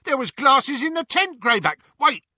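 high, loud, eager henchman; excited yelp. A narrator's reading. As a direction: call out urgently to the gang leader. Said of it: "yelped one of the Snatchers".

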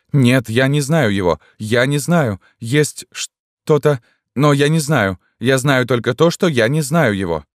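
high, troubled insistence; distressed exclamation. A narrator's reading. Woman struggles with a blocked memory, distressed exclaiming. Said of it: "воскликнула Элизабет".

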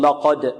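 wrongly dark ل in لقد, coloured by the ق; تفخيم اللام خطأً. Shown as a demonstration. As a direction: say لقد with a heavy ل. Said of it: "البعض ربما يفخم اللام". (لام لقد مفخمة خطأً)

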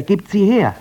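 urgent. Acted. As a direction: demand it quickly. Sister urgently demands the eyes.

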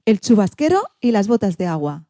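ordinary delivery, nothing marked

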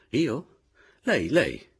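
high, challenging jab; pointed, provoking insistence; goading. A narrator's reading. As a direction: egg them on. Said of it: "affermò ella guardandomi negli occhi, aizzosa".

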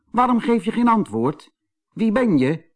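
lightly acted puzzled rabbit, high; high-pitched questioning, a touch put out; Kees Konijn. A narrator's reading. Kees questions the silent doll, mildly indignant.

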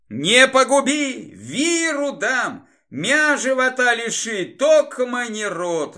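high, drawn-out begging; pleading. A narrator's reading. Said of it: "взмолился рысь". (протяжная высокая мольба)

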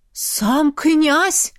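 startled villager's outburst; shocked onlooker. A narrator's reading. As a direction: cry it out in shock. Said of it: "услышала я".

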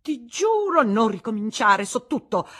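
very high and loud; furious. Acted. Wife silencing her husband, furious. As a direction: cut him off.

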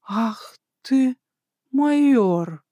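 taken aback, pitch rising sharply; surprised exhale. A narrator's reading. Slava caught off guard by the major's boldness, surprised. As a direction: gasp it out in mild astonishment. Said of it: "От неожиданности выдохнула Слава".

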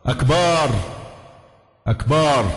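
أكبر with a long last vowel, akbaar; lengthened ba error. Shown as a demonstration. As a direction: stretch the vowel after the b in أكبر. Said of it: "معناها الحيض أو الأكبر الطبل". (مد باء «أكبر»)

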